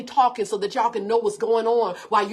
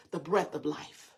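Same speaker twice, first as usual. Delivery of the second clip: hushed emphasis; 7 semitones lower, 7 dB quieter than usual. Acted. Preacher savoring a key phrase.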